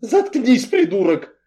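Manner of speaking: loud, high-pitched snap; sharp furious outburst; angry bark. A narrator's reading. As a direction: snap to shut him up. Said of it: "рявкнула я".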